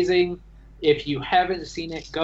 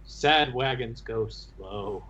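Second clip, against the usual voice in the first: slow, softer take on a character; a hint of a slow-talking character; Jayag imitation; 3 semitones lower, 5 dB quieter, slower than usual. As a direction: attempt his voice lightly, dragging the words. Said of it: "I don't have his voice, but".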